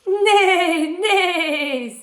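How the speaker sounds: high, loud whinnying "nay"; horse neigh. A narrator's reading. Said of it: "said the horse".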